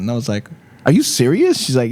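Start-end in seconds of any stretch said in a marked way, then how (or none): 0.8-1.6 high-pitched retold disbelief; incredulous. Comedian retelling his reply to a surprise job offer, incredulous. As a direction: re-enact a startled, disbelieving question.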